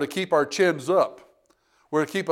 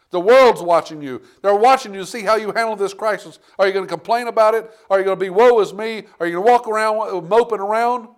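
fervent preaching; 6 semitones higher, 5 dB louder than usual. Acted. Preaching at full force.

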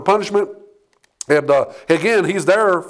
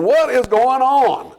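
drawn-out, higher-pitched exasperation; exasperated outburst, pitched up and slowed down; frustrated; 6 semitones higher, slower than usual. Acted. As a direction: act out a mini hissy fit, stretching the words. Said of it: "starting to get a little frustrated".

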